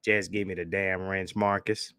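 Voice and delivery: lightly voiced cop demanding the wrench; Officer Charles impression. Streamer imitating cop Officer Charles asking Marcus for wrench, mild stern. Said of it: "my Officer Charles impression".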